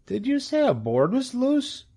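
high-pitched, questioning piglet; puzzled young pig. A narrator's reading. Pig baffled by a fast-talking goose, high and puzzled. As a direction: ask in a high little voice.

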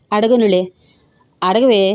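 plain reading voice

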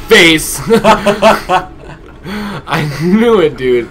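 loud, high laughing outburst; laughing yell. Real, not acted. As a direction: burst out laughing. Gets clubbed, laughs.

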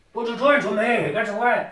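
high, hurried mock protest; playful alarm. Player shooing a virtual creature away, mock alarm.

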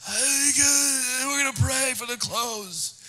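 high, cracking, strained voice breaking on every word; voice cracking from Pop Rocks. Retold or imitated. Preacher recalls his voice breaking from Pop Rocks, cracking high. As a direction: voice suddenly squeaks up and breaks mid-sentence. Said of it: "my voice changed".

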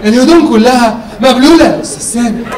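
rising disbelief on the phone; exasperated complaint. Retold or imitated. Complaining call to Sameh.

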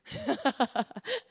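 short chuckle; rueful laugh. Real, not acted. Preacher admitting the message hurts too, rueful chuckle. Laughs after stinging remark.

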